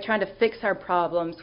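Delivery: ordinary talk, nothing marked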